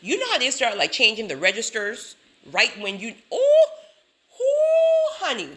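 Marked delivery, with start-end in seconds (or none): 3.3-5.5 very high, loud, drawn-out whoop; exasperated whoop. Real, not acted. Storyteller recalling the checkout line, whooping in exasperation. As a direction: wail the ohs high, then drop on honey.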